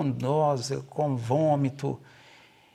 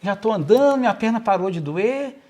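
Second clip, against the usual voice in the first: lightly voiced excited child, a bit higher and louder; a hint of a proud little boy showing he can walk; father imitating his son saying he's better, mildly childlike and louder; son's happy voice; 6 semitones higher, 7 dB louder than usual. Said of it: "ele falou assim".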